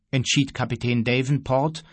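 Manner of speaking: plain reading voice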